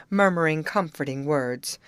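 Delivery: ordinary delivery, nothing marked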